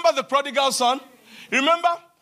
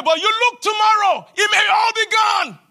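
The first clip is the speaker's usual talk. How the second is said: ordinary delivery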